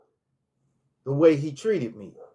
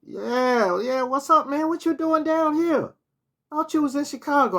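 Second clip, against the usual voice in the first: mock voice of the man, pitched much higher; retelling an old acquaintance's greeting in a much higher put-on voice; imitating him; 12 semitones higher than usual. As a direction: raise your pitch well up to play the guy greeting you. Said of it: "And he said".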